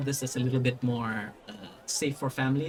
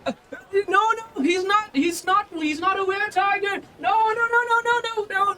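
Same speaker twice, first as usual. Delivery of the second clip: very high, loud, frantic squeal; panicked Volo; 4 dB louder than usual. Acted. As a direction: shriek frantic denials in a squeaky voice. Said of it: "he just goes like".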